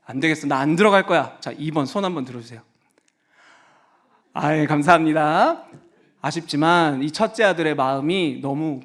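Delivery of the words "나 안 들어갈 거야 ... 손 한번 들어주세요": ordinary talk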